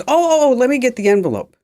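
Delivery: high Vietnamese accent imitation, quick at the end; flustered dry cleaner in a Vietnamese accent. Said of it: "she's from Vietnam".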